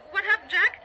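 high, loud, anxious question; alarmed. Acted. Wife alarmed for her husband on the phone, anxious. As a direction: cry out in alarm for an answer.

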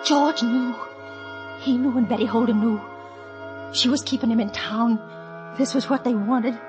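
low, brooding inner monologue; grim realization. Acted. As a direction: realize the betrayal, voice sunk low. Bitter realization of betrayal, narrated.

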